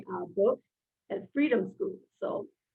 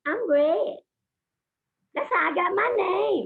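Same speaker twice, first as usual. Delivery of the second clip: put-on child character voice; 11 semitones higher, slower than usual. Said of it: "when I'm red".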